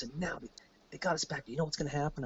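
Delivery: very soft, confiding tone; imitating a pal's quiet grumble; hushed aside. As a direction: keep it way down, just for your buddy. Buddy's side of retold private exchange.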